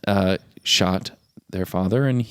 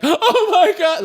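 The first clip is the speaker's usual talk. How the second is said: high, drawn-out mock gasp of shock; hammed-up reaction, laughing through it; overly dramatic; slower than usual. Showing how the actress doesn't overplay it, overly dramatic. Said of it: "not, li-, again, like, overly like".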